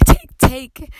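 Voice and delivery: choked up, fighting back tears; words catch as she tries to hold it together; voice breaking. Real, not acted. Preacher choking up while telling her children's story, voice breaking. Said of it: "oh God, I'm trying to keep it together".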